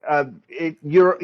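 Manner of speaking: normal talking voice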